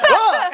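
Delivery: high-pitched startled cry; mock panicked yelp. Band caught on the roof by the owner, mock panicked yelp. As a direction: yelp like you've just been caught.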